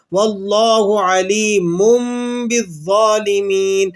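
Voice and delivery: slow chant with علیم ending "mummm" before بالظالمین; iqlab recitation. Shown as a demonstration. Teacher showing how we will read it, iqlab recitation. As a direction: hum the tanween of علیم as m, vowels stretched. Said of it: "کس طرح پڑھیں گے ہم؟".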